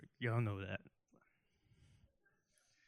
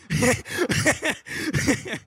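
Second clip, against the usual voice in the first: high, long burst of laughter; high laugh at own joke; 11 semitones higher, 6 dB louder than usual. Real, not acted. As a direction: crack up at your own joke.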